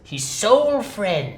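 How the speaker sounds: theatrical narrator; story narration. A narrator's reading. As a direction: weigh each word. Narration shared.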